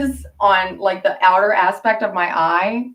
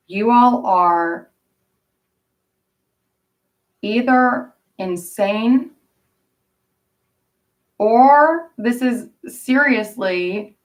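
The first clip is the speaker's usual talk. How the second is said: slow, deliberate accusation; much slower than usual. Real, not acted. Slow accusatory build to family.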